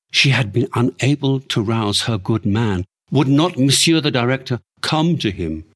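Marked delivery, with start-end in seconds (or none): none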